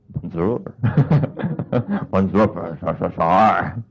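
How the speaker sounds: low, soft, slow mock dog voice; dog speaking French. Preacher joking about a dog speaking French, low and slow. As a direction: mumble it like a dog trying French.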